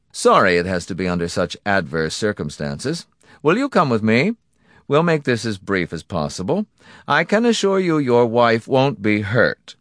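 mock courtesy to a victim; courteous villain. Donati receiving his victim, with polite menace.

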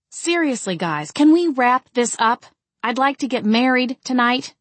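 insistent, exasperated appeal; demanding. A narrator's reading. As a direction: press them to wrap it up. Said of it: "I demanded".

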